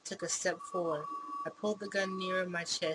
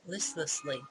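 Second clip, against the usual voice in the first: ordinary delivery, nothing marked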